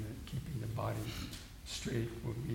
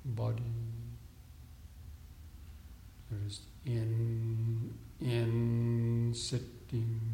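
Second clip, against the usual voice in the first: guided meditation; 4 dB quieter than usual. Real, not acted. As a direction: talk them into stillness, unhurried and steady. Leads seated breathing meditation.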